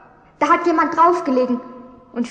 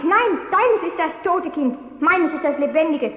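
usual speaking voice

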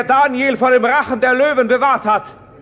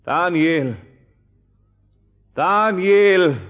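sad and despondent calling; 5 semitones lower, much slower than usual. Acted. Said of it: "rief der König traurig und mutlos nach Daniel".

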